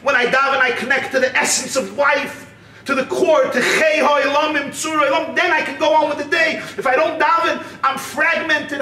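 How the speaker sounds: rising from earnest to high, urgent pleading; mounting, heartfelt protest in a story; impassioned plea. Acted. Acted plea of upset brother.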